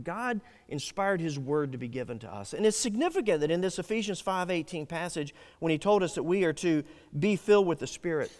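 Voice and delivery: ordinary talk, nothing marked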